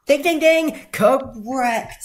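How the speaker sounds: high, loud triumphant ding ding ding, then correct; bell ding imitation. Host celebrates the contestant's right answer with a high, loud bell cry. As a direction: ring out the quiz bell with glee.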